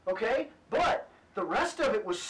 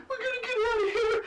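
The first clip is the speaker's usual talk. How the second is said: mock panic; 10 semitones higher, faster than usual. Voicing fleeing party members.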